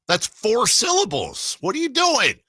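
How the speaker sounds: high, drawn-out exasperated protest. Real, not acted. Host scolding a listener who miscounted syllables, exasperated. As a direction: squeal it in stretched-out disbelief.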